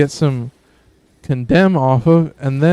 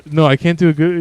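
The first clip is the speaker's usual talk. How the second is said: ordinary talk, nothing marked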